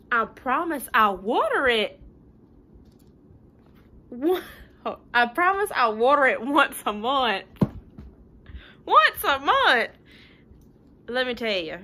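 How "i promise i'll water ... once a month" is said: pleading pigeon. Acted. Pigeon promising loudly.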